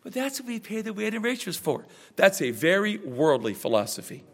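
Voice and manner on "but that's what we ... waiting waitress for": soft, offhand excuse voice; a shrugging church member's excuse; mock objector. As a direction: mimic a lazy churchgoer, quieter. Voicing a worldly objector.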